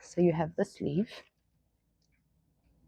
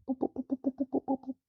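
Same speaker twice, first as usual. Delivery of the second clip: higher, softer playful boops; playful booping; 9 semitones higher, 7 dB quieter, faster than usual. Real, not acted. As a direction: tap his nose with each boop. Booping the dog's nose.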